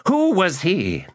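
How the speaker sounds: high, insistent demand. Acted. Magus demanding to know the stranger, high and insistent. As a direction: press her for an answer. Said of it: "Iskaral demanded".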